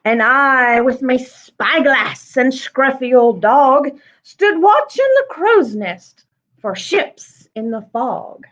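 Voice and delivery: lively rhyming read-aloud; story narration. Acted. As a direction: read it with a bouncy rhythm to children. Reads the picture book aloud.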